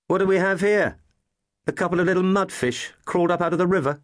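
high-pitched, mocking teenage bully; taunting older boy. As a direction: play an older boy sneering at little intruders. Voices older boy finding intruders.

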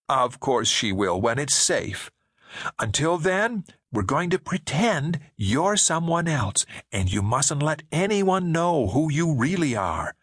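slow, sweetly insistent reassurance; lightly voiced woman. Woman coaxing David to hide who he is, slow and sweet.

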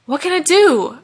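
high, loud worried question; anxious plea for direction. A narrator's reading. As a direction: ask helplessly, voice pushed up. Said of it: "asked Malachi anxiously".

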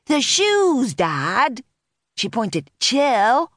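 high-pitched reproach, dropping on the last word; exasperated teen. A narrator's reading. Daughter points out shoes to dad, exasperated.

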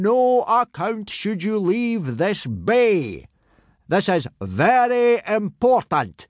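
high, slow, emphatic warning; booming Viking instructor. Acted. Gobber warning boys to stay in the bay, emphatic boom. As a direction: stretch the warning out in a hearty character voice.

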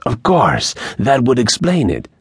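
put-on high-pitched accented exclamation of realisation; bandit realising. A narrator's reading. Bandit works out who sent O'Brien, dawning realisation. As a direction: let it dawn on you, pitch up high. Narrator voices Angel realising.

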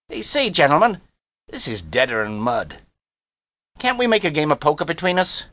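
high-pitched brash youngster; cocky boy Harvey. Acted. Harvey addresses the men.

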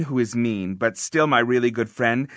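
plain reading voice

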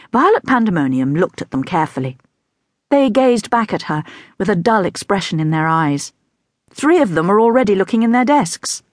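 usual speaking voice

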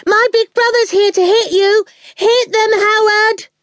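put-on high-pitched child's shout; shouting little girl. A narrator's reading. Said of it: "Awful was shouting".

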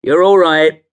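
high, loud, breezy cabbie voice; reassuring cabbie. Acted. Cabbie soothes drunk passenger, breezily loud. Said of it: "said the cabbie".

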